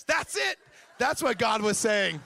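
loud, hammed-up eureka; mock triumphant excitement. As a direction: burst out like an overeager prophet. Imitating prophetess seizing on weak answer.

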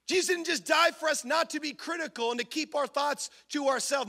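normal talking voice

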